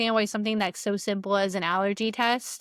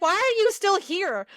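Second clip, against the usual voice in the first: much higher, louder, exasperated scolding; incredulous scolding; 8 semitones higher, 4 dB louder than usual. Retold or imitated. Coworker scolding the sick friend who stayed, incredulous high loud voice. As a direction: demand why she is still here. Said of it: "And I was like".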